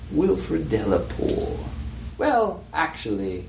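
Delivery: lightly voiced, slow Southern gentleman; Delapour. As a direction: answer slowly, with measured pauses. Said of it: "It's not Delapour's voice anymore".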